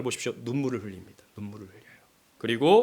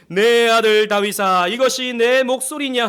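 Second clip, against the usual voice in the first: Saul crying out; 7 semitones higher, 7 dB louder, slower than usual. Retold or imitated. Reading Saul's tearful cry.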